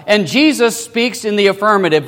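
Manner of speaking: usual speaking voice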